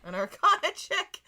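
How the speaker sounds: high, loud incredulous question; loud, astonished squawk of a question; amused disbelief. Real, not acted. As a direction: blurt it back, about to crack up. Surprised at the requested roll.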